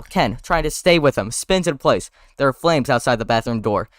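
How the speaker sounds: ordinary talk, nothing marked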